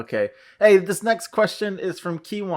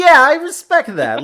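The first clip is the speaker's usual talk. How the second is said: mock respect; 12 semitones higher, 8 dB louder than usual. Retelling reply to a tough kid.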